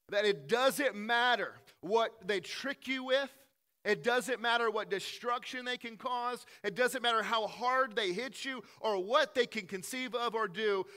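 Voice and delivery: rising pulpit intensity; full-force preaching. Acted. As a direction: preach it out to the back row. Preaching climax.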